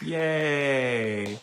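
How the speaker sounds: high, drawn-out little cheer; happy cheer. Real, not acted. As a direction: cheer for their good news. Cheers a viewer's good news.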